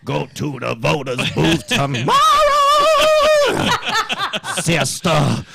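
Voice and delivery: mocking a politician's church voice; mock preacher voice.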